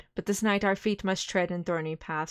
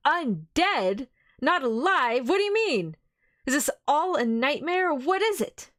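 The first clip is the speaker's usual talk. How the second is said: much higher, louder bewildered cry; horrified disbelief; 7 semitones higher, 4 dB louder than usual. Acted. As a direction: voice climbing in shock.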